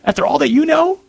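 incredulous inner accuser, climbing high; mock-incredulous tempter, pitch rising sharply at the end; accusing little voice. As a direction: taunt them with a scornful question. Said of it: "this little voice is over here saying".

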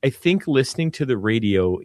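normal talking voice

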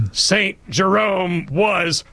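slow, stretched, commanding pronouncement; imperious teacher. Retold or imitated. Speaker borrows his teacher's voice to correct Saint Jerome, imperious and slow. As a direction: channel the stern schoolteacher. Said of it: "invoke the spirit of Missus Champion and say".

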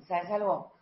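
calm, tender complaint to husband; soft calm tone. Shown as a demonstration. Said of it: "Hablas un poquito así como".

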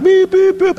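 high-pitched beeping; imitated truck horn. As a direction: honk like an oncoming truck.

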